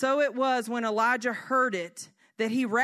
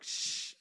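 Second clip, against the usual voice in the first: quick soft shush; self-hushing; 9 dB quieter than usual. Retold or imitated. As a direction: shush your own thoughts. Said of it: "say that to myself".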